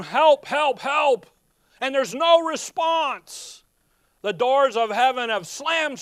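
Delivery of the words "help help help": loud, higher cries for help; crying for help. Acted. Christ crying out with no response, loud desperate calls. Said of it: "I'm crying".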